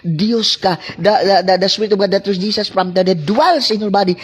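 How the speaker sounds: fiery preaching. Acted. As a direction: preach it hard to the congregation, building. Preaching at full force. (masidhing pangangaral, pataas nang pataas ang boses)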